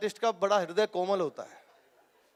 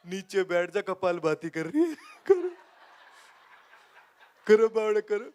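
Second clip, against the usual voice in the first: Baba Ramdev impression. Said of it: "Baba Ramdev".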